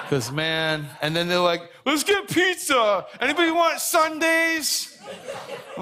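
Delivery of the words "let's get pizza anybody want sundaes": very high, drawn-out singsong holler; exaggerated high-pitched, slow cheery call; mock-excited calling out. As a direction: mimic a gleeful family offering treats. Mimics family tempting him while dieting.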